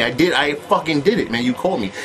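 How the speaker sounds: normal talking voice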